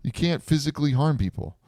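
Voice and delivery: high-pitched imitation of a panicked protest; retold outburst, pitch jumping up high; mock freak-out. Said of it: "Summer loses her mind going".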